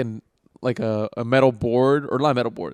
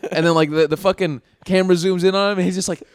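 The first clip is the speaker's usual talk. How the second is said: usual speaking voice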